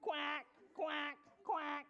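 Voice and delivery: short, abrupt duck quacks. Acted. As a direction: quack like a duck in a read-aloud. Duck pulled out of tummy.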